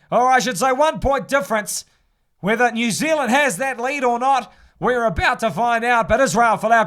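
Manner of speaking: still-lively match call; commentary settling after the try. Acted. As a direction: round off the try for listeners.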